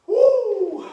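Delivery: loud high whoop of relief; triumphant whoop. Real, not acted. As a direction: let out a big cheer after the set. Shout after finishing set.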